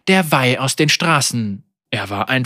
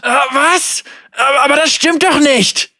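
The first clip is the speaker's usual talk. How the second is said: high panicked denial; terrified; 10 semitones higher, 3 dB louder than usual. A narrator's reading. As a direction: deny it in pain and fear. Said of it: "außer sich vor Schmerz und Angst".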